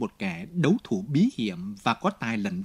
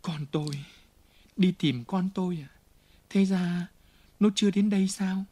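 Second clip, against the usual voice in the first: put-on higher, frantic begging for her child; panicked plea; 6 semitones higher than usual. A narrator's reading. Fantine begging the nun for her child, frantic. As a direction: plead in rising terror.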